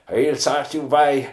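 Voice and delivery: put-on over-the-top Irish traveller accent, high and loud. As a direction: ham up a Cork traveller threat. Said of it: "the most ridiculous traveller accent you ever heard".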